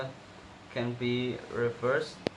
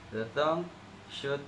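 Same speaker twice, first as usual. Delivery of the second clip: usual speaking voice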